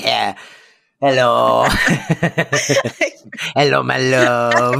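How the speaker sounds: lightly voiced amorous skunk; slow crooning hello, a hint of the cartoon lover; a touch of a smitten cartoon skunk; Pepe Le Pew. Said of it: "Pepe Le Pew".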